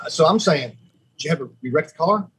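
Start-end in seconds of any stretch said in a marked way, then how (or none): 1.2-2.3 panicked question. Retold or imitated. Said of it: "I was panicking".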